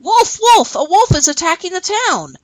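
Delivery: high, urgent cry of wolf; shouted alarm. Acted. Boy tricking a farmer, shouting a false alarm. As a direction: pitch it way up like a boy raising the alarm. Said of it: "ran up to a farmer and shouted".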